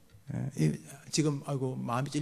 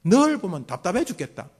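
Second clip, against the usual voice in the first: louder, fed-up parent sigh-scold; exasperated parent; 3 semitones higher, 7 dB louder than usual. Acted. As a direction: let the frustration burst out at the child. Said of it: "네 번째로 재촉". (답답해하는 부모 목소리)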